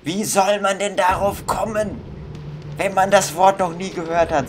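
exasperated complaint. Real, not acted. Gamer ranting at the puzzle word, high indignant complaint. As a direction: protest that nobody could guess this.

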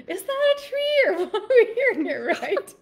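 high-pitched, half-laughing mock alarm; imitated jumpy questioning. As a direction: ask it high, spooked by a noise. Retelling alarmed question about noise.

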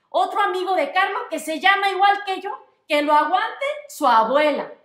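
dog loudly fed up that another guest shares his name; exasperated bark. Acted. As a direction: rant at full volume about another namesake. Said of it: "Ladré con todas mis fuerzas".

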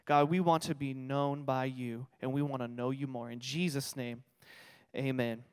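low, soft closing prayer; quiet prayer. Acted. Pastor closing a prayer before the sermon, low and soft. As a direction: pray gently to God.

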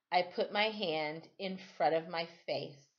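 plain reading voice

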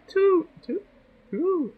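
mock sing-song. Joking miscount of her cats.

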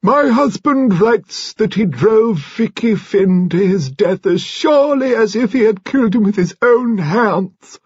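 high, slow, loud declamation. A narrator's reading. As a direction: recite it like an intolerable lesson. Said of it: "raised her voice as if she repeated some intolerable lesson".

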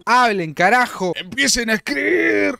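loud, high exasperated yell; yelling at chat. Real, not acted. Gamer yelling at the silent server to start chatting. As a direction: demand they start typing, shouting.

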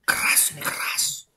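whispering, barely any voice; hushed whisper. Real, not acted. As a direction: whisper it as if nobody should hear. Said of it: "Ngomongnya pelan-pelan".

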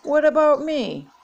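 put-on high-pitched childish wail. A narrator's reading. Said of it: "Becky wailed".

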